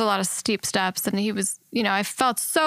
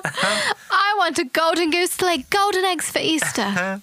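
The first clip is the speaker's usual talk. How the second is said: put-on high, slow spoiled-child demand; put-on high voice, insisting; Veruca Salt; 8 semitones higher than usual. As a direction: draw out a hammed-up bratty demand. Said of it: "a very good Veruca Salt".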